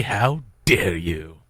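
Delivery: ordinary talk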